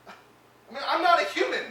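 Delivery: a sudden loud cry of despair; anguished shout. Acted. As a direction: voice leaps up and shouts the last words. Patient's angry outburst at therapist.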